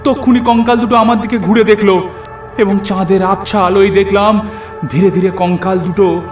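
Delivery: ordinary delivery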